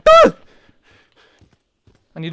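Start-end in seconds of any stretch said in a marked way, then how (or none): 0.1-0.4 short loud yelp of fright; startled scream. Real, not acted. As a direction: scream out at a jump scare. Jump scare.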